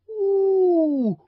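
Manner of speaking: high, loud gasp of wonder; a crowd marvelling at fireworks; awed ooh. A narrator's reading. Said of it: "as though they were looking at fireworks".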